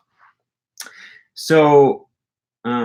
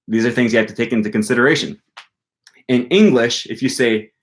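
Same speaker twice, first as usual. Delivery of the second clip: ordinary delivery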